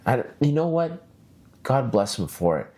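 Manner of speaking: usual speaking voice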